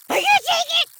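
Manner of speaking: very high, loud desperate cry; squealing puppet breakdown; can't take it. Acted. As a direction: scream like it's too much to bear. Puppet overwhelmed by pain.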